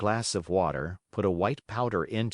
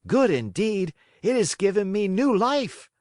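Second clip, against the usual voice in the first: put-on high, loud petulant boy; sulky little wooden boy refusing; whiny puppet boy; 11 semitones higher, 4 dB louder than usual. Pinocchio whining to the fairy, high and petulant.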